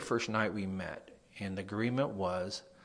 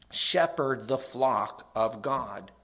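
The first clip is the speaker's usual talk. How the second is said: slow scripture reading; 3 semitones higher, 4 dB louder, slower than usual. Acted.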